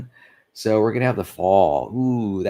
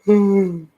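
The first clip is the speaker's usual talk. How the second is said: tired sigh; 12 semitones higher, 6 dB louder than usual. Real, not acted. Exhausted, sighs about poor brain.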